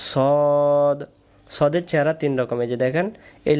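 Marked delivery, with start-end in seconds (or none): 0.1-1.0 সদ held long on a rounded "o"; drawn out. Shown as a demonstration. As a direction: stretch সদ into "sawwwd". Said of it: "টান দিয়ে বলেন". (সদ, টান দিয়ে লম্বা করে)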